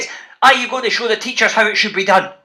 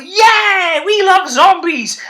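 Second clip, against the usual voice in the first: imitating big kids cheering, higher and a bit louder; mock a crowd of excited older kids; rowdy big kids; 8 semitones higher, 4 dB louder, much slower than usual. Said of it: "the big kids at the back were".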